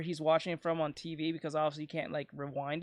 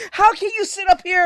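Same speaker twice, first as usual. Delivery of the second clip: much higher, louder, mock-furious rant; furious yell at dad; 10 semitones higher, 10 dB louder than usual. Yelling at his dad for watching episodes out of order, mock-furious. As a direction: blow up at him like you can't believe it. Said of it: "I was like".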